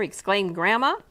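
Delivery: ordinary delivery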